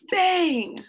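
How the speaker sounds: high-pitched sound word, falling; slamming-door bang. A narrator's reading. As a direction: let the door slam in the word. Narrator imitates a slamming door.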